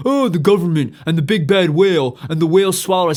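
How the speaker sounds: whiny complaining. Retold or imitated. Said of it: "we're all sitting here going".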